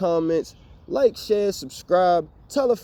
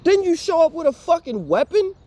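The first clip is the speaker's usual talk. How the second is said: angry rant; 12 semitones higher, 4 dB louder than usual. Real, not acted. Ranting at the landlord.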